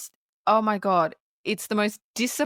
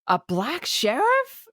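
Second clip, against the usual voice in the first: mock shocked gasp; 7 semitones higher, slower than usual. Voicing the townsfolk gasping at the new sheriff. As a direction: gasp then slow, stunned question.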